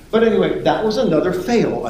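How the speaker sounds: ordinary delivery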